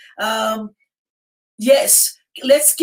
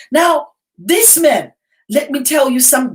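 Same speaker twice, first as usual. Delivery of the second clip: ordinary delivery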